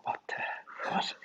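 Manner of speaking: breathless puzzled whisper; whispered confusion. Retold or imitated. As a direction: whisper it, baffled. Reading his own garbled voice note.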